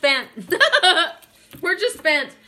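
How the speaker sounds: loud, high voice breaking into laughter; laughing. Real, not acted. Card reader quotes a song after getting upset, laughing. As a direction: laugh heartily through the words.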